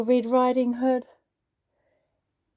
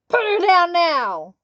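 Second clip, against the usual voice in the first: loud, higher commanding shout; bellow; 6 semitones higher, 6 dB louder than usual. Acted. Woodcutter commands the wolf to drop Little Red, bellowing. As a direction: order the wolf off her. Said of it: "bellowed the woodcutter".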